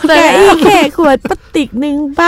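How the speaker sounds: high, put-on old boomer voice; whiny boomer. Still mocking boomers, a high whining old voice. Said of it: "ต้องทำเสียงแบบนี้ด้วยนะ".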